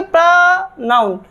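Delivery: "प्रोनाउन" drawn out as praaa-naun; slow model pronunciation. Shown as a demonstration. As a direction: slowly split "प्रोनाउन" after a stretched pra. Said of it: "क्या बोलेंगे?". ("प्रोनाउन" खींचकर, प्रा... नाउन)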